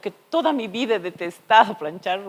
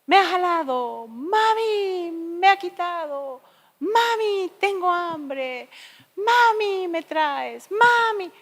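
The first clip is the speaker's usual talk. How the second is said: put-on high whining kid calling mami; whiny child; 10 semitones higher, 4 dB louder, slower than usual. Children nagging their mother all day, high whiny voice. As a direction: nag like a small child. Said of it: "Todo el día es".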